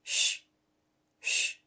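quiet rustling hiss; soft shushing. A narrator's reading. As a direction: breathe it like grass rustling. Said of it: "c'était un drôle de bruit".

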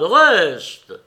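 loud, high desperate shout; frightened shout. A narrator's reading. Soldier calling his comrade back, frightened shout. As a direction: yell it after him, scared. Cria d'une voix effrayée.

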